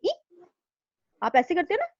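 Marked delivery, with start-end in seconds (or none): 0.0-0.4 "ई" jumps much higher, short and sudden like a pinch; pinched yelp. Shown as a demonstration. Teacher shows the pinch sound: a sudden high "ई". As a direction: yelp "ई" as if pricked by a needle. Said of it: "आवाज कैसे आती है".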